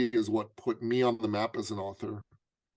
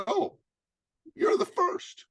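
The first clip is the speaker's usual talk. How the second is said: much higher, matter-of-fact; lightly voiced coach; slower than usual. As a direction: say it breezily. Coach's retold answer.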